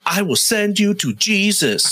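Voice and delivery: mock threat from a cartoonish father; mock angry dad. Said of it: "threatens his son, and says".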